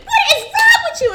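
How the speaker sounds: very high, loud exasperated shout; retold yelling. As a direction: yell it at a kid who won't stop crying. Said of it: "He's just yelling at me".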